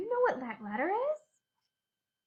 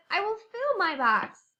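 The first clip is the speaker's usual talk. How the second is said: louder, higher voice for little F; character line; 6 semitones higher, 6 dB louder than usual. Acted. Narrator voicing little F filling her box. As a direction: voice the little fire girl with bright energy. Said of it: "She said".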